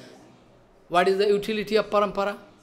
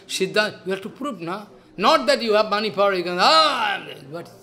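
ordinary delivery

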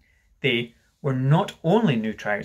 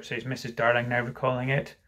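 plain reading voice

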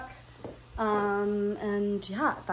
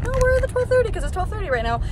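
exasperated; 11 semitones higher, 5 dB louder, faster than usual. Retold or imitated. Retelling annoyed reply to realtor.

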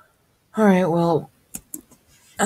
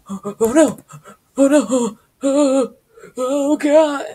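high, loud panicked yelps of oh no; panicked cries; 10 semitones higher, 6 dB louder, slower than usual. Real, not acted. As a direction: cry out in real alarm as the game goes wrong. Panicking in the game.

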